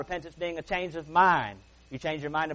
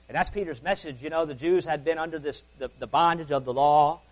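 ordinary talk, nothing marked

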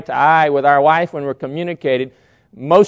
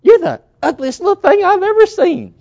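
put-on high, loud cooing to a baby; gushing sing-song baby voice; baby talk; 13 semitones higher, 6 dB louder than usual. Shown as a demonstration. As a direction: coo sweetly at a baby, voice way up. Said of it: "with a tone of voice say".

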